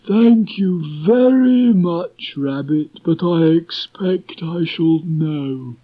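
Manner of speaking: slow, high, gloomy Eeyore voice. A narrator's reading. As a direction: drag it out like a gloomy old donkey. Eeyore's reply to Rabbit.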